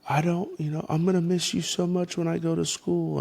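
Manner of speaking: put-on high, quick child's voice; daughter's voice. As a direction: play the little girl sad to leave. Dad voicing daughter Lily.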